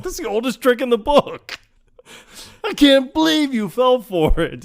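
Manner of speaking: high, loud, incredulous taunt; hammed-up scolding of someone easily fooled; mock exasperation. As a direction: crow in exaggerated disbelief. Acted scolding of gullible opponent.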